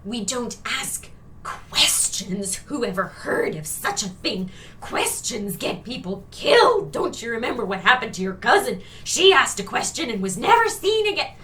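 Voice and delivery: hammed-up backward-thinking parent forbidding questions; fearful controlling parent. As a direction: parody of a frightened parent's warning. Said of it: "these very fearful, backward-thinking, controlling parents".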